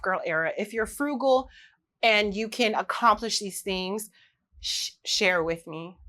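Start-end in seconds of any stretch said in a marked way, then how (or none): none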